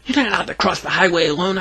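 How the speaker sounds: high-pitched mild yelp. A narrator's reading. Boy scolds Greta for crossing alone, mild high yelp. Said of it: "I yelped".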